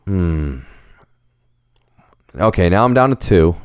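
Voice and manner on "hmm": low pondering hum; thinking hmm. Real, not acted. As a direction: hum while choosing. Deciding on a pick.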